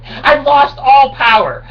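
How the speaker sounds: high, loud, slow crying wail; hammed-up lament over lost power; mock wail. Wail then flat repeat.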